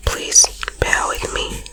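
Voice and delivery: usual speaking voice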